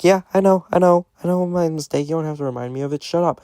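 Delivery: high-pitched, fed-up rant, retold; exasperated retort. Husband answering wife's I-told-you-so, high exasperated retort. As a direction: retell it as a high, exasperated comeback. Retold quarrel with his wife.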